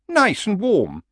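high, coaxing horse voice. Acted. Horse sells retirement to old barbarian, high and coaxing.

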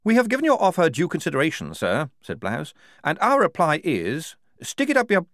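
a stiff lieutenant giving a formal refusal; lieutenant Blouse. Acted. Lieutenant delivers formal defiant reply.

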